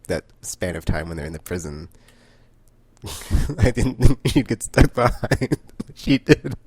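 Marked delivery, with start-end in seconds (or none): 3.0-6.7 loud, high, slow words squeezed through laughing; real laughter. Real, not acted. Fan surprised she got stuck behind, laughing.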